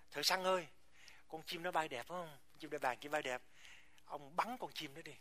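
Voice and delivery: hushed, sly aside to the hunter; speaking low. Acted. Jealous eagle quietly asking a hunter to shoot its rival, speaking low. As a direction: drop your voice, as if confiding. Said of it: "mới nói nhỏ".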